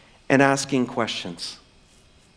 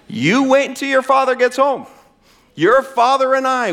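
high, raised mock scolding mother; stern mom threat; 9 semitones higher, 4 dB louder than usual. Mom warning her son, playfully stern. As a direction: amp up the heat like a mom. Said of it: "Moms have used that for centuries".

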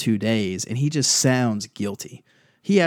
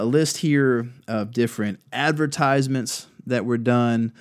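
ordinary talk, nothing marked

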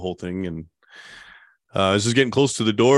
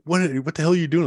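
alarmed woman; 7 semitones higher, faster than usual. Retold or imitated. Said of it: "She's like".

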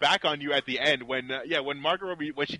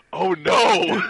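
loud mock-dismayed cry; dismayed reaction; 7 dB louder than usual. Viewer reacting to real interview footage, loud mock dismay. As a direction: exclaim it in exaggerated dismay.

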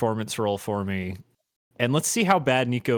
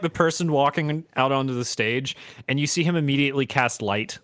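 usual speaking voice

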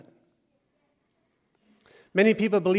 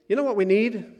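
usual speaking voice

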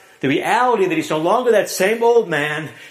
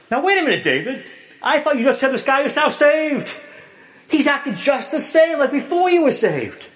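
skeptical objector; 7 semitones higher than usual. Pretend. Imagined listener challenges David, higher protesting voice. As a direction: play a doubter cutting in.

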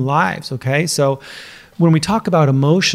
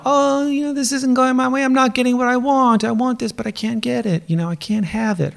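put-on high, whining toddler voice; whiny toddler; 9 semitones higher than usual. Coach mimicking a toddler in a tantrum, high whine. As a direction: whine it like a toddler who can't get her way. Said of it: "they're like".

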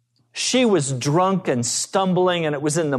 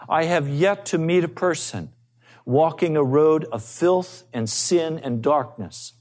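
normal talking voice

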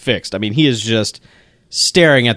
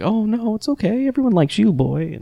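much higher, mock-sweet soothing; 9 semitones higher, 3 dB quieter than usual. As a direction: coo at the dog, lightly mocking. Soothing the dog.

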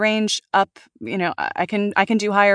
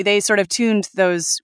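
ordinary delivery

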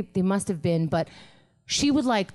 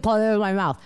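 normal talking voice

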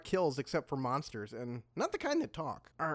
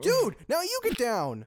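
high, louder urgent warning; urgent shout; 8 semitones higher, 4 dB louder than usual. Acted. Friend warning friend of arrows, urgent shout. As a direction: yell for your friend to duck.

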